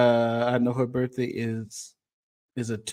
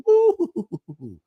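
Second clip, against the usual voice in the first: high whoop of joy; joyful high-pitched holler; cheering whoop; 7 dB louder than usual. Real, not acted. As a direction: let out a high celebratory woo. Cheering at the feast.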